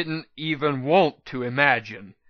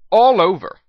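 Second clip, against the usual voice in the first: bright, lifted, louder reply; answered brightly; 6 semitones higher, 10 dB louder than usual. Acted. Shop manager greets the newcomers, brightly and loud. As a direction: chirp it out like a cheerful shopkeeper. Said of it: "answered brightly".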